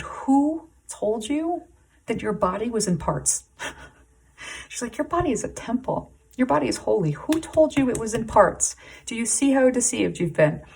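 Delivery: goddess speaking. Acted. Hecate challenging the viewer about their body, high and emphatic. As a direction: deliver it as a knowing divine rebuke. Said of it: "And then she said".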